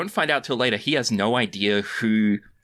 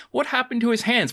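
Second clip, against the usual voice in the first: put-on innocent kid voice; 12 semitones higher than usual. Quoting kid on shirt.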